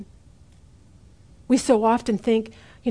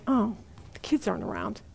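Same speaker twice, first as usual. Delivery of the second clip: much softer, sneaky self-justifying thought; quiet inner excuse; 9 dB quieter than usual. Retold or imitated. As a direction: murmur it like a guilty little excuse. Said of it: "You think".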